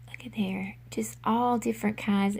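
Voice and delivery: soft excited whisper over the buttons. Real, not acted. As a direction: breathe it out in a whisper. Showing buttons up close.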